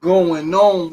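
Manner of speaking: loud, high, hyped-up show opener; high energy host shout-out; hype greeting. Real, not acted. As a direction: fire up the audience with a big greeting.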